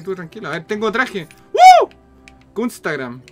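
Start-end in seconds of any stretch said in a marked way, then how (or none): 1.5-1.9 loud high whoop; excited whoop. Real, not acted. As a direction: cheer wildly. Cheering on getting costume.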